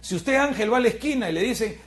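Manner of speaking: usual speaking voice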